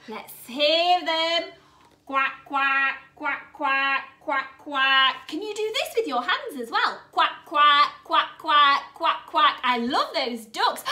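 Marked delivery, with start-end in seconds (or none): 2.1-5.3 low, evenly spaced duck quacks. Pretend. Teacher leading children in duck sounds, low steady quacks. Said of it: "what voice sound do you think those ducks will make?". 7.1-9.6 low, evenly spaced duck quacks. Pretend. Teacher leading children in duck sounds, low steady quacks. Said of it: "I love your duck voices".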